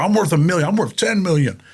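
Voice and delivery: put-on bragging kid voice. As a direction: lightly voice a teen outbidding himself. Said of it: "you're like".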